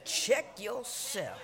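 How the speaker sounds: ordinary delivery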